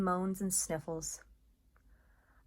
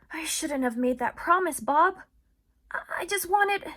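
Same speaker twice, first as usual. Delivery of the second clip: higher and louder, pained confession; regretful; 10 semitones higher, 9 dB louder than usual. Acted. Ivan admitting fault to Bob, pained.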